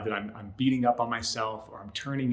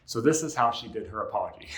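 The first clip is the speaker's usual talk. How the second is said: normal talking voice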